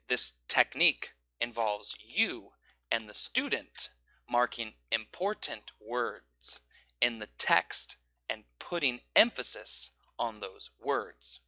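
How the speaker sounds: slow, key words gently stressed; soft, slow natural stress; natural emphasis. Shown as a demonstration. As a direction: mark the important words naturally. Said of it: "So you'd say".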